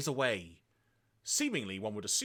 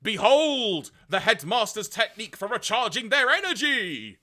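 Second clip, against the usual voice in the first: cartoon narrator; 8 semitones higher, 7 dB louder than usual. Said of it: "And the narrator says".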